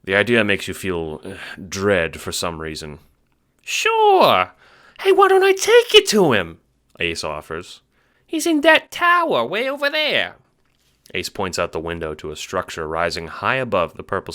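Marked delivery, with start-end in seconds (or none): 3.6-6.6 high squeaky Italian-American chipmunk; fast-talking little mobster, squeaky; Ace, chipmunk wiseguy. Acted. Little guard talks to the Seer, squeaky wiseguy. 8.3-10.4 high squeaky Italian-American chipmunk; fast-talking little mobster, squeaky; Ace, chipmunk wiseguy. Acted. Little guard talks to the Seer, squeaky wiseguy.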